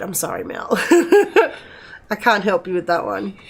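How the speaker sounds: ordinary delivery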